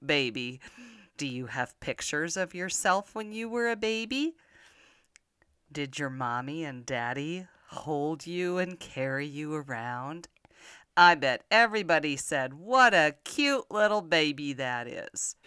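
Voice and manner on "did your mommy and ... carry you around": usual speaking voice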